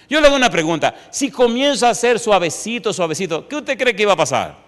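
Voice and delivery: high, loud, driving sermon delivery; fired-up preacher rising in pitch; preaching at full force. Acted. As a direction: hammer the point home to the congregation. Heated preaching aside.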